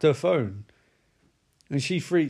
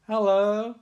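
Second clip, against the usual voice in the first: slightly higher, rising greeting; an awkward dad's hello; 5 semitones higher than usual. Retold or imitated. John Saxon greets Nancy, awkward rising hello. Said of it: "He's sort of like".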